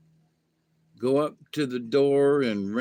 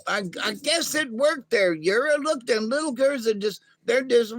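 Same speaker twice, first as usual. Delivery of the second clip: high excited alien; 9 semitones higher, 3 dB louder than usual. Acted. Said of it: "Ima says".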